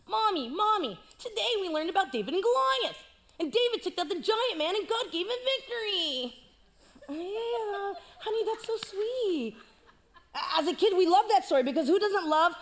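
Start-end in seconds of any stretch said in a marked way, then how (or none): 0.0-6.3 put-on high, eager kid voice; child telling mom about church; excited child. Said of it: "rated G version". 7.1-9.5 doting mom. Retold or imitated. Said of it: "rated G version".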